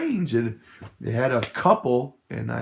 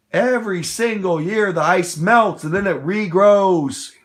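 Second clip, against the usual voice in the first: higher, louder, hammering the point; heated rant; 6 semitones higher, 5 dB louder than usual. Real, not acted. Ranting to viewers about the polar bear ad, loud and heated. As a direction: raise your voice and drive it home. Ranting against media climate claims.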